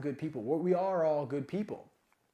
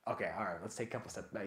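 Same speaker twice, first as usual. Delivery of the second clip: much lower and softer retreat; sheepish backing off; 9 semitones lower, 10 dB quieter, faster than usual. Retold or imitated. Coach backing down after a startled reaction, quiet retreat. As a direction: drop low and quiet, easing off.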